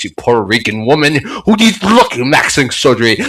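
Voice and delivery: ordinary talk, nothing marked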